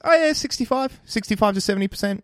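high put-on voice for the truth; squeaky candid version; mock honest voice. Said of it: "what that actually means is".

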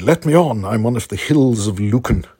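indignant demand. Retold or imitated. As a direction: call out high and loud, demanding to be let in. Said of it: "hammered on the door and said".

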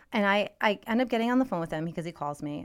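usual speaking voice

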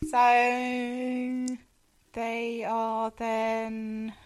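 very drawn-out words; stalling for recall. Real, not acted. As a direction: stretch each word while searching for what follows. Stalling while recalling the plot.

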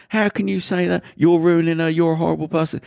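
high-pitched mock outrage; indignant friend. Friends retold angrily scolding bouncer.

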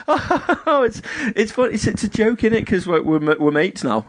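high put-on voice, laughing into the words; imitated giggly girl. Girl caught drinking his pint, mimicked in high giggly voice. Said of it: "she starts laughing".

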